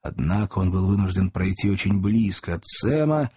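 usual speaking voice

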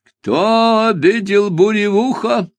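slow, high raspy repeat; slow ominous raspy drawl. Acted. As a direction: drag the question out ominously. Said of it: "невидящими, неподвижными глазами и снова спросил".

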